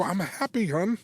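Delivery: high-pitched mock protest; retold defensive reassurance. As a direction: act out insisting to a spouse.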